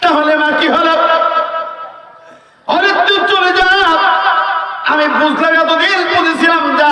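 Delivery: high, ringing sermon delivery; preaching at full force. Acted. Preacher raising his voice to the crowd, fiery sermon. Mother sends son away.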